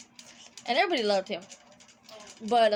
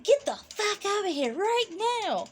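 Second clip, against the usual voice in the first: mock angry yell; 8 semitones higher than usual. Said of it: "she yelled at my name".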